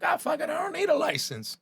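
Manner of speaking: high, carefree dismissal; careless shrug. Retold or imitated. Young cook brushing off his DUIs, high and carefree. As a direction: toss it off lightly. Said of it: "So I'm like".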